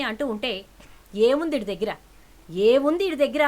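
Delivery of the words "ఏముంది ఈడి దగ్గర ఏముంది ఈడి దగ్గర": second ఏముంది ఈడి దగ్గర louder and higher; angry repeated question. Acted. Father scorns the cousin, building to an angry shout.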